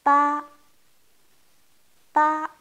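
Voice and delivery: « Pa » fort et net; « Pa » lancé avec force; ba comme papa. Shown as a demonstration. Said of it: "Comme pas dans le mot papa". (ba, comme dans papa)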